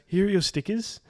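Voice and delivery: Dutch: spottend vrolijke verkoopster; mock chirpy saleswoman. Lady at counter, voiced quote.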